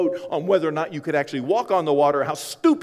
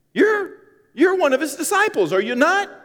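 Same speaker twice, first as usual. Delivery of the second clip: much higher, louder needling question; high accusing servant; 6 dB louder than usual. Acted. Servant at the fire pressing Peter, high and accusing. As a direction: pin him down with a shrill-pitched question.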